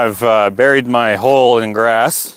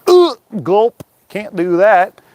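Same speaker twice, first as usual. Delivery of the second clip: mock dismay; 9 semitones higher, much slower than usual. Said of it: "And I was like".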